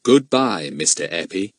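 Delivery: ordinary delivery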